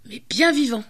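ordinary delivery

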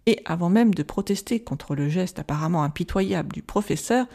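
ordinary delivery, nothing marked

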